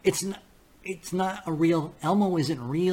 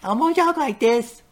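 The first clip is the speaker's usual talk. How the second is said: high, slow, squeaky puppet voice; much higher, slow and louder, like a kids' puppet; Elmo; 10 semitones higher, 5 dB louder than usual. Retold or imitated. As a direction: do a little red puppet talking in the third person. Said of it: "you ever heard Elmo?".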